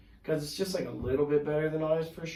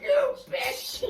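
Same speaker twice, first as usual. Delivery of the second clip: mock crowd heckle. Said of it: "the crowd, like, is like".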